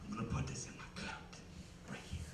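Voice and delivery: hushed, conspiratorial whisper; furtive whisper. Acted. Rebel sneaking a pop into the movie theater, whispering. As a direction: whisper it like you're smuggling something in.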